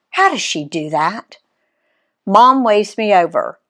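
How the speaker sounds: plain reading voice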